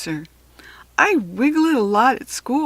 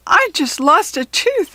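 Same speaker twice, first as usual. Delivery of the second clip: a higher, louder happy announcement; excited shout; 7 semitones higher, 5 dB louder than usual. Acted. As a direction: call it out to the whole class. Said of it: "I shout".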